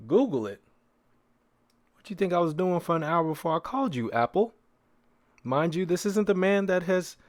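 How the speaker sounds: ordinary talk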